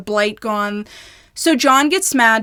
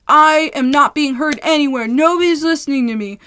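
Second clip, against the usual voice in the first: higher, louder mock outrage; mocking neighbor's fuming rant, raised; 5 semitones higher, 5 dB louder than usual. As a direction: imitate a man fuming at being ignored. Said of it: "He is just fuming".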